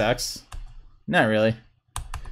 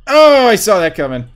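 excited outburst; 6 semitones higher, 8 dB louder than usual. Real, not acted. Player who read the opponent's move, excited outburst. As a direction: crow it loudly, falling away.

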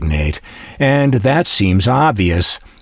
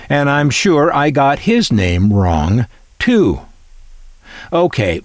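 ordinary talk

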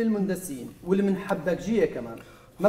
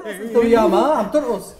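much louder, higher yell with a laugh; angry yell; 6 semitones higher, 10 dB louder than usual. Acted. Father yelling at the dancing aide, angry yell. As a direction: snap at them to stop.

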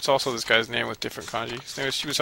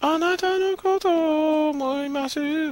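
put-on much higher, slow, sugary girlish singing voice; mock anime girl; slower than usual. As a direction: ham up a lovestruck idol, dragging it out. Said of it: "She's like".

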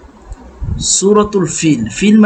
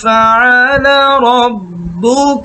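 slow melodic tajweed chant; Quran recitation; 4 semitones higher, much slower than usual. Shown as a demonstration. Model Quran recitation with music. (ধীর সুরেলা কুরআন তেলাওয়াত)

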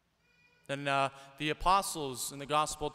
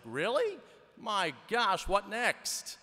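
high, incredulous "really, what next"; exasperated disbelief; 6 semitones higher, slower than usual. Retold or imitated. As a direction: throw up your hands at one more disaster. Apostles exasperated by ghost appearing.

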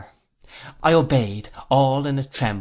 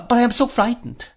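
frightened; 11 semitones higher, 4 dB louder than usual. Acted. Dora confesses fright to her sweetheart.

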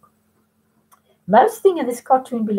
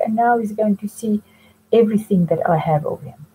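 ordinary delivery

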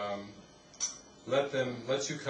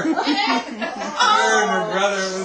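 slow, high, loud words through laughter; laughing; 9 semitones higher, 13 dB louder, much slower than usual. Real, not acted. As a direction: speak while laughing hard. Laughing retelling.

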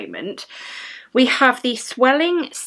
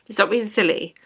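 ordinary talk

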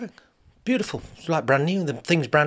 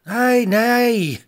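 gruff Scottish lord; 10 semitones higher, 7 dB louder than usual. Acted. Scottish man answering.

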